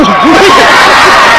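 breathy burst of laughter; airy rising laugh. Real, not acted. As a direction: crack up at the punchline. Laugh after punchline.

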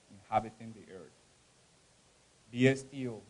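dramatic spoken-word poem, loud lines falling to hushed ends; poem recital. Acted. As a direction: recite it like a grieving mother's plea. Poem recital at reading event.

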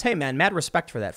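usual speaking voice

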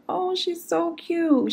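high, slow cooing; cooing neighbor. Retold or imitated. Neighbor cooing over the barking puppy, high and slow. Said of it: "she was like".

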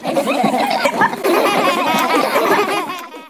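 high rodent giggles; long, squeaky giggling fit; squealing laughter. Acted. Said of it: "squealing with laughter".

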